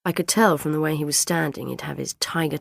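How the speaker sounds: ordinary delivery, nothing marked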